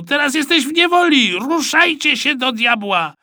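high-pitched exasperated order; shouting. A narrator's reading. Supervisor telling captured boy to move, shouting. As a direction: lose patience and yell. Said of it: "krzyknął Rosół".

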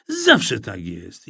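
ordinary delivery, nothing marked

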